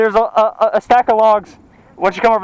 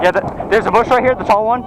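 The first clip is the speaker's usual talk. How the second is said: ordinary talk, nothing marked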